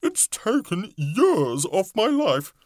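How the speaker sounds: high, slow ladybug voice. A narrator's reading. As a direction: complain like a tiny bug. Ladybug continues.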